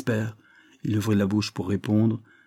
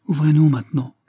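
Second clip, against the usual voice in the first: higher, louder order; raised-voice command; 6 semitones higher, 7 dB louder than usual. A narrator's reading. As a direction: give a firm order to open up. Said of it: "commanda monsieur Méchinet au gardien".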